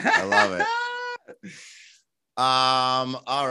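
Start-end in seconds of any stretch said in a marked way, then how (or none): 0.0-1.5 high laughing squeal; laughing shriek. Real, not acted. Laughing at shirtless guest.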